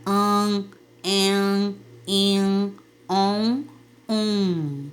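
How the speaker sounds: An, en, in, on, um held nasal, one by one; nasal vowel demo. Shown as a demonstration. Teacher demonstrating nasal vowels to the class, slow and nasal. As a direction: hum each of An, en, in, on, um through the nose. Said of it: "sons que parecem que a gente tá segurando o nariz".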